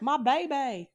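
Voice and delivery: bad Australian accent. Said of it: "that was a terrible Australian accent".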